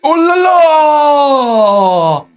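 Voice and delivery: a fan crying out in despair; anguished shout. Real, not acted. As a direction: wail it out like the match is lost. Fan reacting to a big moment.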